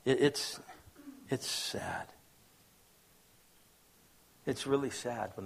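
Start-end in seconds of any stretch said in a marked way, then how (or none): 0.0-2.1 whispered sorrow. Real, not acted. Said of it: "sorrow at unforgiveness".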